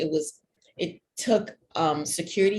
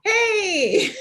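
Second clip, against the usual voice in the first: high, loud hey with a laugh; excited greeting; 5 dB louder than usual. Real, not acted. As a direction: burst in, delighted to be heard. Poet greets audience once unmuted.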